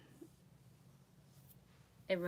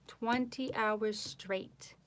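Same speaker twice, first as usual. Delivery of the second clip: slow, drawn-out "Twenty hours straight"; slow emphasis; 4 semitones higher, much slower than usual. Real, not acted. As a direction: lay out "Twenty hours straight" slowly, word by word. Repeats the boast.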